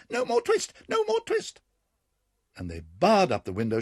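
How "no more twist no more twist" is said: shrill very high squeaky shout; twittering mouse shout. Acted. Mice shouting at the cat together, very high and loud. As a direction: shout it all at once like tiny mice. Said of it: "began to shout at once in little twittering voices".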